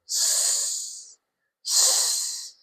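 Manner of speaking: "S, s" as two long voiceless hisses; S sound. Shown as a demonstration. Teacher showing the S sound, S sound. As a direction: hold a soft hiss for each "s", no vowel. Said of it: "S sound".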